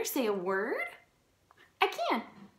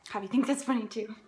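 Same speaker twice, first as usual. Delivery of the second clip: ordinary delivery, nothing marked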